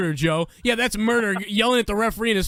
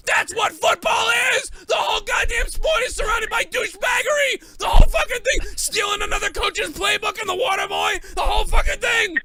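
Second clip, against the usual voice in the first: unhinged rant; 5 semitones higher than usual. Real, not acted. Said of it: "Did [name] forget his Xanax again?".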